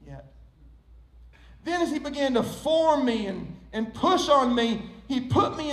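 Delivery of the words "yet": soft, low, calm reply; gentle, lower, unhurried refusal; smiling potter. Acted. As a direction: answer quietly with a patient smile. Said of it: "the potter smiled and said".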